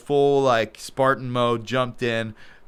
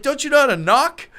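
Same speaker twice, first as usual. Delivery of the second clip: imitating Ronda's rebuke; 12 semitones higher, 3 dB louder than usual. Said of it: "She's like".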